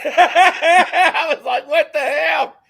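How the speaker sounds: high, loud words through real laughter; squealed out between bursts of laughter; cracking up. Real, not acted. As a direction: tell it while helplessly laughing. Cracking up retelling a gaffe.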